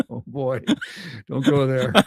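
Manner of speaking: words broken up by laughter; laughing. Real, not acted. Co-host reacting to the rude mishearing, laughing. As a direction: warn them off while cracking up.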